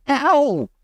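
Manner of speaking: sudden pained yell; boy yelps as the alarm fires. Acted. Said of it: "and he yelled".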